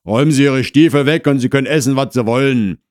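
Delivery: loud, rapid command; gruff husband ordering. Acted. Husband to neighbour about boots and dinner, ordering loudly. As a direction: bark the terms quickly from indoors.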